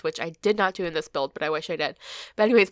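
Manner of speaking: ordinary talk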